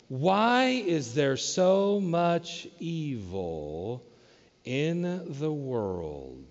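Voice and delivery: very slow, drawn-out modern lament; anguished question. Shown as a demonstration. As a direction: stretch every word like a heavy sigh. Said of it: "That's how we would ask it today".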